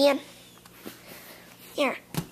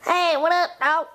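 normal talking voice

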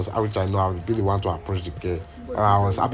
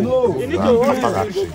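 ordinary talk

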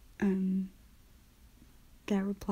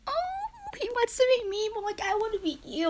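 higher, bubbly replay of her own thought; giddy inner voice; 14 semitones higher than usual. Retold or imitated. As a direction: squeal it like a thrilled fan. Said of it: "I was like".

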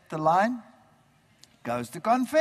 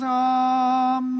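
higher, louder droned amen; congregation amen; 5 semitones higher, 4 dB louder than usual. Retold or imitated. Whole congregation answering at mass with a droned amen. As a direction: mimic a whole church answering by rote. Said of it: "the whole congregation goes".

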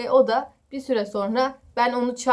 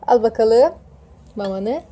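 louder firm pet commands; firm commands to a cat; 2 semitones higher, 9 dB louder than usual. Real, not acted. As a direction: tell the cat to sit and wait.

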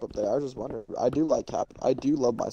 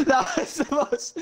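laughing; 11 semitones higher than usual. Real, not acted. Laughing through remark.

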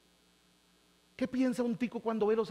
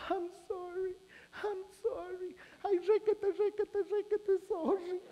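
much higher, crying wail of sorry; mock sobbing gringa; 11 semitones higher, 3 dB quieter, much slower than usual. Gringa sobs sorry over the baby, hammed-up high wail. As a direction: blubber the apology like a weepy foreigner.

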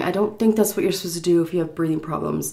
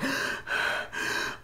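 heavy voiceless breathing; imitated struggle for air; gasping breaths; 13 dB quieter than usual. Crying woman stared at by drivers, gasping. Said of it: "like this".

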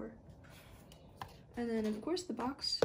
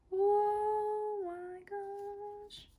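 slow drawn-out high oh my gosh; long amazed exclamation; awed gasp; 9 semitones higher, much slower than usual. Real, not acted. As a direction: stretch it out in wonder. Seeing iPad in full.

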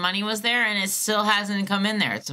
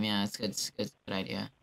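much lower and softer; sarcastic mutter; 10 semitones lower, 10 dB quieter, faster than usual. Real, not acted. Caller muttering sarcastically about the agent, low and soft. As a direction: mutter it under your breath.

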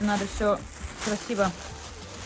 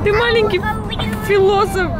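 delighted cooing; 11 semitones higher, 5 dB louder than usual. Real, not acted. Cooing at baby.

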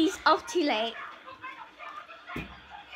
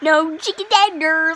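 put-on childish threat; 13 dB louder than usual. Repeats the taunt louder.

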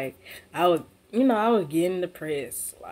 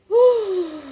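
loud exhale; 9 dB louder than usual. Real, not acted. Overwhelmed by virus news.

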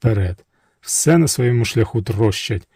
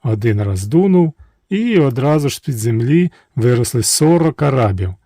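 ordinary delivery

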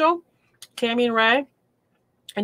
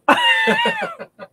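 loud high laugh; 6 dB louder than usual. Real, not acted. Reader amused by a twin comparison, loud high laugh.

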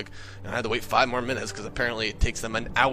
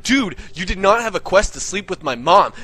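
loud, higher argumentative retort; indignant protest; 6 semitones higher, 6 dB louder than usual. Retold or imitated. Gamer contradicting Jimmy about his mom, indignant and loud. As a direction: insist hotly, as in a retold quarrel.

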